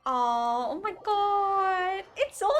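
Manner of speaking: ordinary talk, nothing marked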